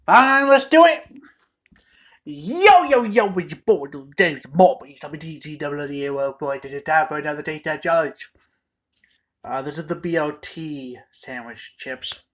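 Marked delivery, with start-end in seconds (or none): 0.1-1.2 loud. Real, not acted. Loud mock-reluctant agreement. 2.2-8.3 loud hyped host intro; hype intro. Acted.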